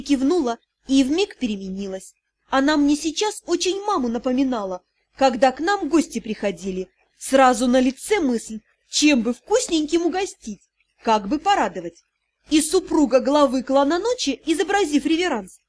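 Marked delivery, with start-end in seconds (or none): none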